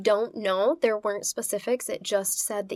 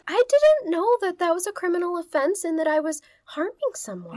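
high, loud mocking voice; mocking the killer; 9 semitones higher, 5 dB louder than usual. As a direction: play innocent badly. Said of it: "he also claims".

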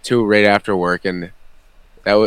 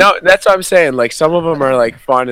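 excited outburst; 7 semitones higher, 7 dB louder than usual. Real, not acted. Heated point in costume debate.

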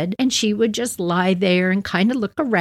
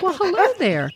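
high, sweet hello; a friendly little dog saying hi; sing-song dog greeting; dog's greeting voice; 11 semitones higher than usual. Pretend. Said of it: "she was like".

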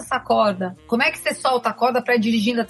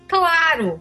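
confident husband; 6 semitones higher than usual. Retold or imitated.

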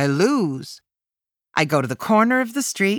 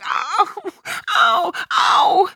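much higher pained cries of ow; crying in pain; 14 semitones higher, 4 dB louder, much slower than usual. A narrator's reading. Child in the dentist chair, crying out in pain. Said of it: "I cry".

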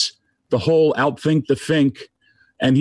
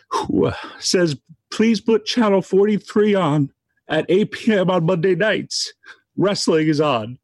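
real crying; 4 semitones higher than usual. Real, not acted. Friend recalling the late Howard's medical note, crying. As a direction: voice pitched up with tears, pausing. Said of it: "I'm sorry for crying".

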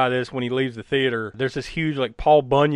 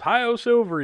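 retold yell; 10 semitones higher, slower than usual. Bill riding his bike down the street, retold yell. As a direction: imitate a triumphant call in a contained voice. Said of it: "and he yells".

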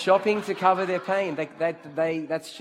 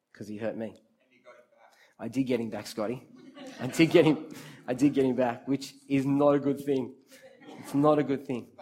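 usual speaking voice

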